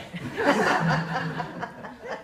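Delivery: breathy chuckle at his own punchline; wheezy laugh. Real, not acted. Old soldier laughing at his own army joke, wheezing. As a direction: laugh at the joke you just told.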